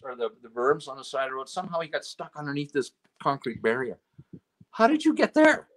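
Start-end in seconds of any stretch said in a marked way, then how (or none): 4.7-5.7 high, loud mock-astonished shout; incredulous question. Bandmate to a drunk friend stuck under a barrier, incredulous. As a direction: call it out in loud, high-pitched disbelief.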